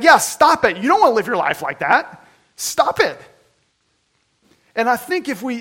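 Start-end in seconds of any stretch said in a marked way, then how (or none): 0.0-3.2 higher and louder, hammering stop it; blunt insistence. Retold or imitated. Counselor to patient, louder blunt repeat. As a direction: bark the order back at her. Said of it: "And he's like".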